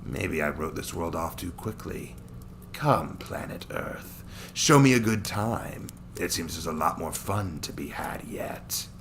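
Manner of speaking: a cruel Saiyan amused by his prey; sneering villain. Acted. Daikon eager for a fight.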